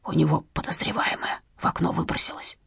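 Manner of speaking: unvoiced hushed whisper. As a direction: breathe it, no voice at all. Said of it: "перешёл на шёпот".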